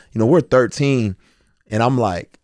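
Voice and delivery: ordinary delivery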